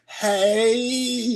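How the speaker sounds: high, hugely stretched Southern "hey"; endless syrupy Southern drawl. Retold or imitated. Young lady answering the big bodyguard, endless Southern accent. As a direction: imitate a Southern girl, stretching the vowel on and on. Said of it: "it just went on forever".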